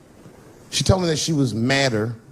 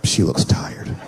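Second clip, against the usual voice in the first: unvoiced, lower whisper; whisper to self; 8 semitones lower, much slower than usual. Man in bed backing off his tired wife, whisper. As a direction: breathe it out to yourself. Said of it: "you're like".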